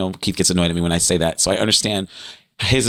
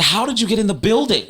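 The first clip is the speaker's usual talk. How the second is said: high-pitched comic bewilderment; baffled; 12 semitones higher, faster than usual. Retold or imitated. Asking the Lord after he vanished, baffled. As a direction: ask in baffled, raised-pitch disbelief. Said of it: "I was trying to figure that out".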